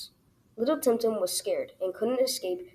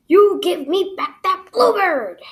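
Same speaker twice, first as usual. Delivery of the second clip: slow, loud, high bellow; big scary voice; 10 semitones higher, 10 dB louder, slower than usual. A narrator's reading. Little Tim Tim poses as monster to alligator, bellowing. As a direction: boom it out like a great big monster. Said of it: "got scared of the big voice".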